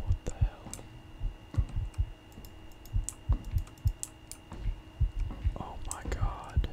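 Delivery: hushed, frightened whisper; scared whisper. Real, not acted. Player creeping through a horror game, scared whisper.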